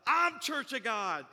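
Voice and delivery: loud, stretched exclamation; acted delighted surprise. Woman finds the pastor shares her church, acted delighted surprise. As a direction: blurt it out, hammed-up amazement.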